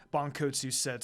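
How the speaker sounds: ordinary delivery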